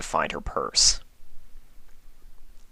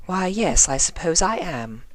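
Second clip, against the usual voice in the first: high, playfully coy; coy refined lady; 11 semitones higher than usual. Acted. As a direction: flirt a little with the officer. Rarity voiced, flirting.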